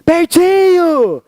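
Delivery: high, very slow, stretched happy call; delighted mom calling her son; mom's happy voice. Retold or imitated. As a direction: sing out the name like an overjoyed mother. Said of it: "Minha mãe tava com voz de feliz".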